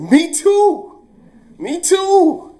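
very high, loud, delighted; eager squeal. Retold or imitated. Teacher thrilled the office worker hunts the thief, high loud squeal. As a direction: squeal it out in glee.

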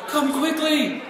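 high, loud urgent shout; acted cry of alarm; urgent call. A narrator's reading. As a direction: call the villagers over in mock alarm. Boy raising a false alarm.

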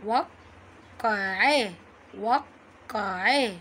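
"وقعاه" very slow, waq-qa-'a; shadda demonstration. Shown as a demonstration. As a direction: hold a long stop on the q of "وقعاه". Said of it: "بالشده".

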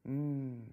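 low, pleased mmm of tasting fruit; girl in the fig tree savouring fruit, contented hum. Acted. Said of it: "La petite se régalait".

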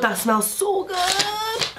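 high, slow swooning delight; delighted squeal. Real, not acted. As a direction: squeal over the smell. Swooning over the scent.

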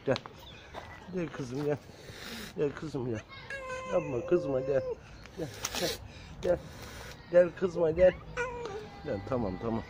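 soft, hushed calling to a cat; soft coaxing. Real, not acted. Coaxing the angry mother cat closer, softly.

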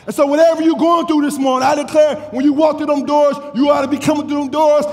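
high, driving exhortation; fervent preaching. Acted. Preacher telling worshippers how to come through the doors, fervent preaching. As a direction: urge the congregation on in rhythmic phrases.

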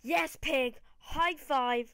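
loud cheer; excited cheer. Real, not acted. Gamer cheering his Pokemon, loud. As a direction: celebrate the hit. Celebrating a win.

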